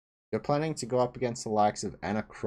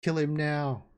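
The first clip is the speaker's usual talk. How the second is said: mock scream; 6 semitones higher than usual. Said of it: "The head's just screaming".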